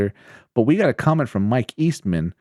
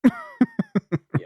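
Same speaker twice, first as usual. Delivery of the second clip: high laugh. Real, not acted. Laugh at power joke.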